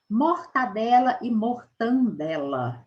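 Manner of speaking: mortadela then mortandela with an added n, slow; contrasting forms. Shown as a demonstration. Teacher contrasting right and wrong forms, slow. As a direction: set mortadela against mortandela slowly. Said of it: "o correto é mortadela".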